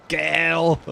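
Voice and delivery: exaggerated "Gail!" with a stretched ay sound; mocking girl pronunciation. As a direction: poke fun by calling "Gail!" for girl. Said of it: "making fun of her and going".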